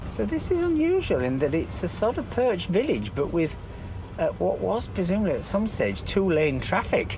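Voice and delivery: loud, lively guide voice; tour guide commentary. Acted. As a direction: present the village like a guide.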